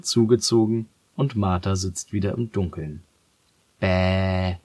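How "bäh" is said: frech. Acted. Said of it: "streckt sie ... Zunge heraus".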